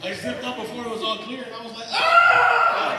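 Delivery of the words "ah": high, loud yelp of pain; pained scream. Retold or imitated. Comic reliving zipper pain, high loud yelp. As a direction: cry out from a zipper injury.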